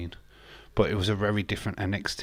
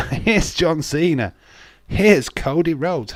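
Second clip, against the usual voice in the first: high, loud, drawn-out mock reveal; boastful announcing; 6 semitones higher, 6 dB louder, slower than usual. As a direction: announce each star like a trump card. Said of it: "That's a company what's just saying".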